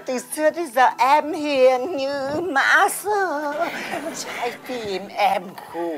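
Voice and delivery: slow, softening put-on sweetness; demure. Woman claiming to be gentle after cursing, demure. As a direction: drag it out, meek and innocent.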